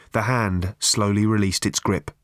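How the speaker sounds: plain reading voice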